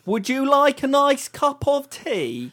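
put-on high, loud, slow caricature British accent; mock English accent. Joining the joke about Americans imitating Brits, in a mock English accent. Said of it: "imitate English accents when they're over here".

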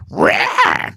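very high, rasping imitation screech; pterodactyl shriek. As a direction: shriek like a prehistoric bird. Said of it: "like a pterodactyl".

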